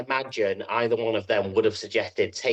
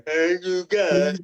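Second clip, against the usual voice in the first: high, slow, drawn-out himbo greeting; dopey joke-character voice; 8 semitones higher, much slower than usual. Retold or imitated. Player mocking the gorgeous NPC as a dim hunk, slow and high. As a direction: drag it out like a pretty but dim hunk. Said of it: "and go".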